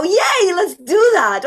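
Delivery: loud, high-pitched cheer; excited child. Acted. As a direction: squeal it like a thrilled kid. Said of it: "what does a child sound like?".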